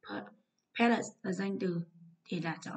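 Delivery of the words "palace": "palace" with PAL stronger, falling away after; stress on first syllable. Shown as a demonstration. Teacher going through stress answers, "palace" stressed on PAL. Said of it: "còn lại là âm tiết thứ nhất".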